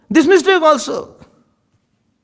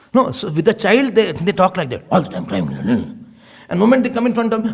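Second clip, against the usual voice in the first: ordinary talk, nothing marked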